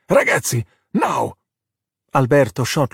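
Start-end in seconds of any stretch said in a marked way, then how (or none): 0.1-1.4 understated urgent call; mock scream. A narrator's reading. Ercole charges at Alberto, mildly urgent yell. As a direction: give a restrained battle order.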